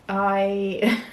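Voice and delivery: usual speaking voice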